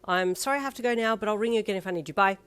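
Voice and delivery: fast, hurried goodbye; rushed. A narrator's reading. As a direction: rush off the phone. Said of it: "you said quickly".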